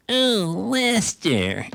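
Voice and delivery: put-on high, drawn-out drunken lilt; sing-song Southern drawl up high; Truman Capote impression. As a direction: croon the name up high like a tipsy Capote. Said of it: "'cause he talked like that".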